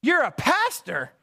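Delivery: high-pitched astonished questions; surprised interest. Retold or imitated. Old auto parts worker learning customer is pastor, surprised. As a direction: pitch jumps up in mock amazement. Fred's surprised reply acted out.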